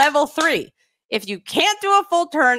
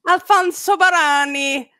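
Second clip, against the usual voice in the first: higher-pitched, relishing Italian accent; 4 semitones higher than usual. Shown as a demonstration. Host delighted by inventor's name, playful Italian accent. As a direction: echo the name with Italian flourish. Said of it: "say it with the Italian accent".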